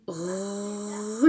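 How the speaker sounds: "ru" with a long held r sliding into the vowel; drawn-out syllable. Shown as a demonstration. Teacher demonstrates the tap sound to pupils, drawn-out "ru". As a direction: stretch "ru" out in one continuous sound. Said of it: "Et ça va faire".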